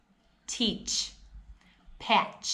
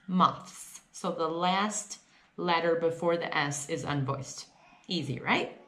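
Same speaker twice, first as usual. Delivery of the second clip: ordinary talk, nothing marked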